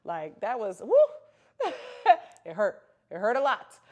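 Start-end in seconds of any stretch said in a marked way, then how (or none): 0.8-1.7 loud, high-pitched woo of remembered pain. Real, not acted. Telling viewers about breastfeeding pain, loud high woo. 1.8-2.4 loud, high burst of laughter. Real, not acted. Laughing at her own pain story, loud high laugh. 2.5-3.8 emphatic. Real, not acted. Stressing how painful latching was.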